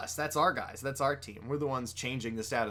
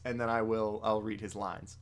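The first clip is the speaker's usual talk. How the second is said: ordinary talk, nothing marked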